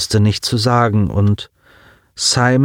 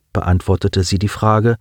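ordinary delivery

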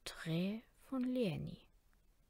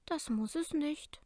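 put-on light girl voice; 7 semitones higher than usual. A narrator's reading. Leni's avatar answers Kara.

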